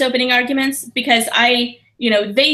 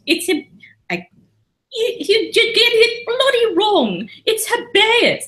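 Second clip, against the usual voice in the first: high, slow, bad British accent; bad English accent; 14 semitones higher, slower than usual. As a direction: ham up a terrible posh English accent. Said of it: "my English accent is fucking awful".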